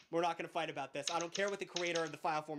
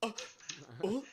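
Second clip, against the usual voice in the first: lightly voiced anime character gasp; a hint of a startled anime character; mock anime gasp; 4 semitones higher than usual. Host mocking anime characters' noises, mock gasp. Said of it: "they let out a little".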